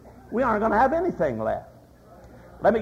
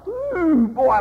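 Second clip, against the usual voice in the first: high, drawn-out ooh of delight; 10 semitones higher, 5 dB louder, slower than usual. Retold or imitated. Mel admiring a chartreuse Chevy, high and delighted. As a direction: swoon over the shiny car.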